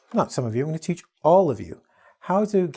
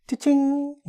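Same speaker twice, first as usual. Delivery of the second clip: cash register sound; 13 semitones higher than usual. Acted. Ten dollar word.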